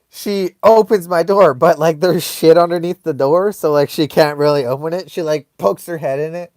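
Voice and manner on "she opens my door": usual speaking voice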